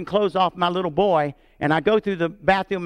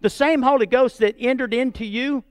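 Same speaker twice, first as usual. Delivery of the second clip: higher and louder, rising in fervour; fervent preaching; 7 semitones higher, 4 dB louder than usual. Real, not acted. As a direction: preach it with full conviction. Pastor rises to fervent preaching.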